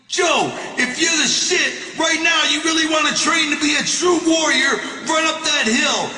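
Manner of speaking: high, driving voice in the head; urging inner voice. Acted. Inner voice urging Joe to train, high and insistent. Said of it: "Some voice in my head would tell me".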